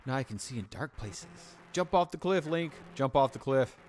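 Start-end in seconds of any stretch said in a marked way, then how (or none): 1.7-3.8 loud urging. Real, not acted. Streamer urging Link to jump, loud. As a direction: shout the command.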